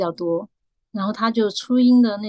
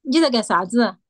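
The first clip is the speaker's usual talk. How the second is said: firm Chengdu Sichuan dialect once more. Shown as a demonstration. Asked to repeat by the host, in forceful Chengdu Sichuanese. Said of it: "可以再说一次吗".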